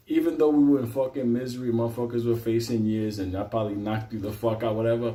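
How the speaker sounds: ordinary delivery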